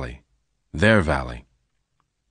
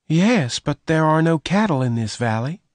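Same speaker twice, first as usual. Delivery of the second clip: put-on lightly raised, higher girl's voice; higher voice for the rustler's girl; young woman Bess; 10 semitones higher than usual. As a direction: voice Bess higher and gentle-toned.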